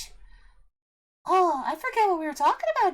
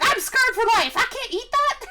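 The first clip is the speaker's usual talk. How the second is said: put-on childish outburst; 7 semitones higher, 5 dB louder than usual. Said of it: "I was like".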